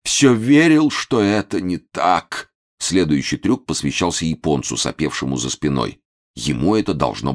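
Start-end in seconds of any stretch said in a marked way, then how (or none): 0.0-2.5 slow lament with a high swell; despairing. A narrator's reading. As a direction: lament like a betrayed husband. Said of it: "Закрыл руками лицо". (горестно, с надрывом)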